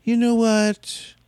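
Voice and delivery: drawn-out, sage-like delivery; mock wise speech. Quoting Jonah's wise line.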